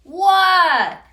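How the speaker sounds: very loud, high, jolted reply; startled what. Acted. Froggy caught staring, loud and high. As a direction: snap out of staring. Startled frog answers teacher again.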